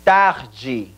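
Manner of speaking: TARDE as "TAH-jee", breathed h for r; Portuguese pronunciation. Shown as a demonstration. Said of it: "Una vez más".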